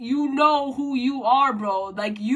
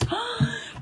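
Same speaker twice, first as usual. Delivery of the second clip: sharp gasp; 10 semitones higher, 6 dB quieter than usual. Real, not acted. Catching breath while crying.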